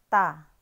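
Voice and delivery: "Ta." punched out loud and falling; modelling "Ta." for students; Chinese ta. Shown as a demonstration. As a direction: stamp out "Ta." like a drill. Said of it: "ang ibig sabihin ng ta".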